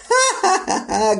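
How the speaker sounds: high-pitched giggling burst; big laugh. Acted. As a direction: laugh hard at the joke, high. Laughing at the bicycle joke.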